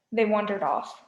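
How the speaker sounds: usual speaking voice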